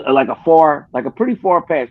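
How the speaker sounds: ordinary delivery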